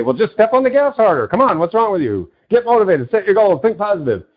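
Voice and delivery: mock a rah-rah speaker pushing you on; hyped motivational speaker. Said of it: "a motivational speaker will say".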